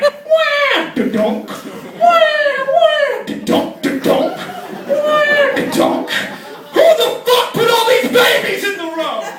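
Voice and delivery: high yelled wham with thuds; mock crash noises. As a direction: shout the crashes, panicky. Driver hitting babies, acted.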